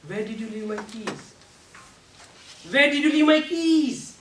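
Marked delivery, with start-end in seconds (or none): 2.7-4.2 higher and louder exasperated question; same words pushed high and loud; high pitched. Shown as a demonstration. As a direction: jump the pitch way up to show upset. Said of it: "with just a pitch".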